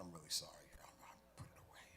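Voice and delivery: whispered apology, almost no voice; sheepish whisper. Acted. Boy whispering sorry to his teacher, hushed. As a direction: breathe the apology out guiltily.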